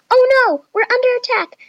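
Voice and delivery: high, loud, frantic cry for help; screaming in alarm, very high and loud; panicked scream. A narrator's reading. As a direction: shriek it in a high-pitched panic. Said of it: "screamed Chloe".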